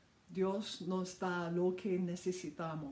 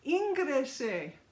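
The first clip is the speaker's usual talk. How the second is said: shouted call; 5 semitones higher, 4 dB louder than usual. Retold or imitated. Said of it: "gritó al hombre en el techo".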